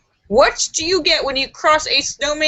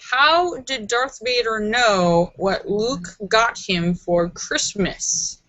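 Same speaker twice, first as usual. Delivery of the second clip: slow setup; 2 semitones lower, slower than usual. Acted. Reading joke setup slowly.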